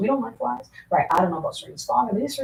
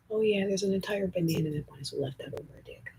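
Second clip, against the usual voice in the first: whisper; 6 dB quieter than usual. Real, not acted. Whispered aside about banana.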